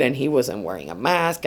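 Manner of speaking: ordinary delivery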